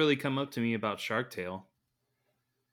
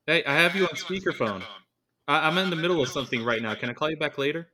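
parent on speakerphone; 6 semitones higher, 6 dB louder, faster than usual. Pretend. Busy caller on speakerphone.

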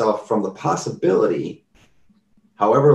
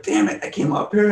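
a hammed-up irritated curse, pitched higher; mock angry outburst; 6 semitones higher than usual. Stranger at the store door, cursing in mock frustration. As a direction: play the annoyed stranger grumbling at himself. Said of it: "got super pissed one time".

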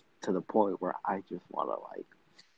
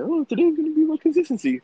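high, loud mock-bubbly announcement; mock chipper; 9 dB louder than usual. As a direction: parody your own earlier cheerfulness. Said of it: "when we were like".

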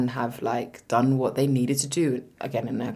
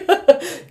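loud laugh; 5 dB louder than usual. Real, not acted. Cackling at the joke.

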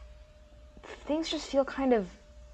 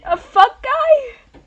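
baffled; 12 semitones higher, 11 dB louder, much slower than usual. Acted. Mishearing Buckeye.

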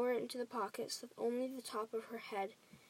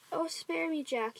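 high-pitched begging cry; yelled plea; 6 semitones higher than usual. A narrator's reading. Giant pleading with dreamed-up Jack, high frightened yell. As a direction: beg for mercy at a high pitch. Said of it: "he yelled".

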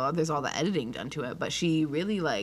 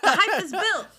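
high mock declaration; 12 semitones higher, 5 dB louder, slower than usual. Repeats it higher, different voice.

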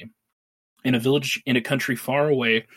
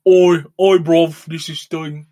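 hammed-up London "oi bruv", slow and loud; 3 semitones higher, 8 dB louder, slower than usual. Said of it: "how we wish it was [name]".

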